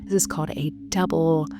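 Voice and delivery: guided meditation. Shown as a demonstration. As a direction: lead the breathing gently, letting each cue hang. Leads a guided breathing practice.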